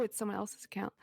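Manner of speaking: whispered aside. Real, not acted. Aside about Twitter account.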